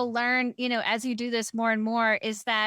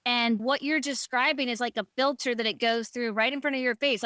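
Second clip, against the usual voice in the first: ordinary delivery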